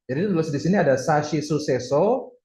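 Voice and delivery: usual speaking voice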